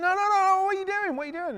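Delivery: high-pitched, frantic imitated protest; loud yelped objection easing off at the end; mock panic. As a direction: imitate a man desperately trying to stop them. Owner panics as grinder approaches machine.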